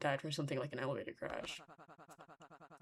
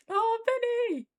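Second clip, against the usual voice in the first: very high, loud squealed exclamation; piercing drawn-out wail; wailing cry; 8 dB louder, slower than usual. Real, not acted. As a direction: squeal out the name in distress. Crying out for a favorite character.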